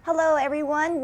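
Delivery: ordinary delivery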